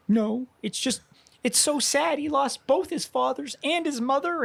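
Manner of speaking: high, choked-up imitation of a crying wife; mock teary wife. Imitating his wife tearfully defending her crying. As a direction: squeak it out like she's holding back tears.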